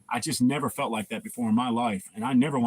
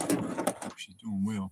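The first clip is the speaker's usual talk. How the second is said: slow, soft, lightly voiced Samuel L. Jackson; a hint of Samuel L. Jackson, drawn out slowly; 5 semitones lower, 7 dB quieter, much slower than usual. Wishing Robert's wife well, lightly voiced slow Samuel L. Jackson. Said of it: "Samuel L. Jackson's voice".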